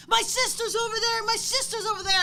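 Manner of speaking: high, loud panicked shouting; a scared kid crying out for his sister. Retold or imitated. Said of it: "started freaking out and panicking".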